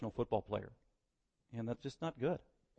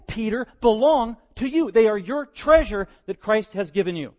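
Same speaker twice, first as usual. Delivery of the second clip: preaching at full force; 8 semitones higher, 14 dB louder than usual. Acted. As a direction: thunder it at the congregation.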